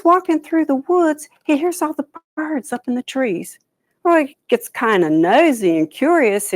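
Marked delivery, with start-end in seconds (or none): none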